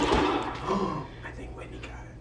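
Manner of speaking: soft, slow, breathy whisper. Real, not acted. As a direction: hush it out slowly. Tense duel moment.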